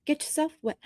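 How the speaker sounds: soft teasing Get yourself wet; teasing a viewer with a mock mirror affirmation; mock mirror pep talk. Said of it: "Look at yourself in the mirror and go".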